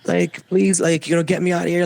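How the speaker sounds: imitating her high, excited plea; high-pitched retold cry to be rescued. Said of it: "prematurely excited".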